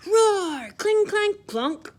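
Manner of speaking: loud, high roar and clang; loud battle noises. Acted. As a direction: shout the fight sounds out. Battle noises acted loudly.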